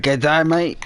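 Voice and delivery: a light mock Australian greeting; a hint of Crocodile Dundee; Australian accent. Imitating an Aussie soap character, light Australian accent. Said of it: "proper Crocodile Dundee".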